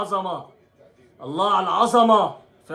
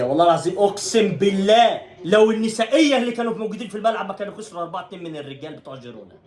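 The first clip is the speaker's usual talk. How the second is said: ordinary talk, nothing marked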